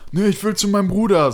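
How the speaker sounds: high-pitched put-on voice; old drunk's whine. Homeless man answers where he wants to go, high imitated voice. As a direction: plead as the confused street drunk. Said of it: "Und er so".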